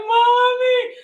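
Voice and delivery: put-on high loud child wail; kid crying. Pushed-over child crying for his mom, high and loud. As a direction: call for mommy like a hurt kid. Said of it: "she was like".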